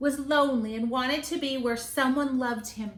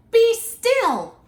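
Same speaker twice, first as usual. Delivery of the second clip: a sharp, loud command, much higher; commanding shout; 11 semitones higher, 11 dB louder than usual. Acted. Max taming the wild things, loud command. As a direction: stop them dead with it. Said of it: "Till Max said".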